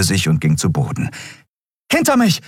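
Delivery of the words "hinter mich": urgent high-pitched shout; called-out command. A narrator's reading. Andriel calling to pale Miko under fire, urgent shout. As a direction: call out a protective order. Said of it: "rief Andriel dem kreidebleich gewordenen Miko zu".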